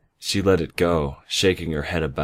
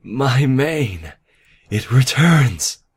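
high, loud, elated exclamation; joyful cry; 8 semitones higher, 7 dB louder, much slower than usual. A narrator's reading. Nightmare rejoicing as her mane returns, joyful cry. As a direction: cry out in joy, stretching the last words. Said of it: "she cried out joyfully".

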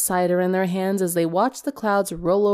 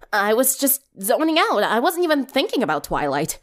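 flustered denial; 5 semitones higher than usual. Acted. Sunset embarrassed denial.

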